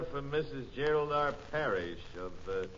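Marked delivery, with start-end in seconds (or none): none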